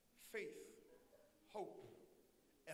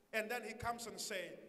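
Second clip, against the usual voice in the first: ordinary delivery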